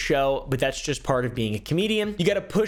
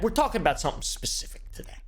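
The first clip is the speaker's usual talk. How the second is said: hushed aside whisper; 5 semitones higher, 4 dB quieter, faster than usual. Real, not acted. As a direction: whisper it under your breath. Narrator aside, unvoiced.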